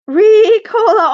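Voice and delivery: slow, loud, drawn-out mountain call; imitating the cough drop ad's call; Ricola commercial call. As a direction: belt it like the alpine ad.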